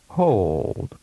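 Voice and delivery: "Hold." held long and falling; drawn-out "Hold."; long vowel. Shown as a demonstration. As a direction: stretch the o of "Hold.". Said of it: "and then with a longer duration".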